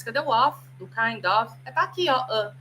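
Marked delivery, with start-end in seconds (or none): none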